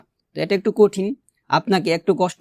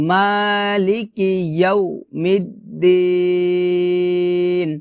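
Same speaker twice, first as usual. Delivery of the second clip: measured chanting for students to repeat; Quran recitation demo. Shown as a demonstration. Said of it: "আমার সাথে বলেন".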